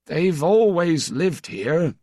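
high, slow, puzzled; puzzled old priest. Acted. Old priest to the stranger, puzzled, high and slow. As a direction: answer gently baffled.

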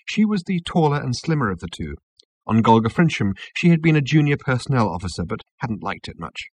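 ordinary delivery

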